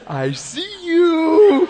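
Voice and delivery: high, loud, drawn-out singsong; playful mirror greeting. Retold or imitated. Preacher spotting God in the rear view, playful high singsong. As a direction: sing it out slowly, like peekaboo. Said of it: "And I go".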